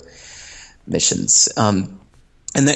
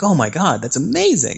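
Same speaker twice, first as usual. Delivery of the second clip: higher voiced, delighted gasp of wonder; amazed customer; 7 semitones higher than usual. Musicians told there's no catch, mock amazed and high. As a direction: mimic a thrilled caller.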